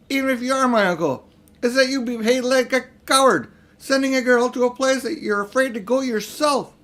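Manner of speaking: high, loud angry outburst; a boy flaring up at his uncle. A narrator's reading. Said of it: "His cheeks were flaming with anger now".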